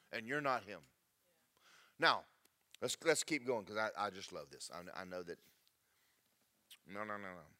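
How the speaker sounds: ordinary delivery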